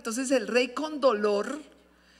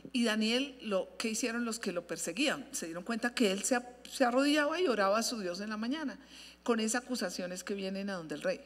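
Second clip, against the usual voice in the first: ordinary talk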